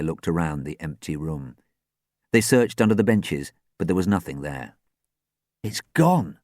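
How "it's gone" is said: dejected announcement; mild gloom; glum. A narrator's reading. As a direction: say it with sinking spirits. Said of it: "said Dan glumly".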